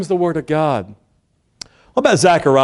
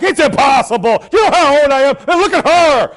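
loud, high protest, hammed up; mock-exasperated shouting; incredulous outburst; 11 semitones higher, 7 dB louder than usual. Zacharias protesting to the angel, loud comic disbelief. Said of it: "But not Zachariah. He's like".

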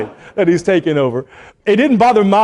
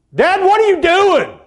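mock son challenge; 12 semitones higher, 5 dB louder than usual. Son questioning dad, mocked.

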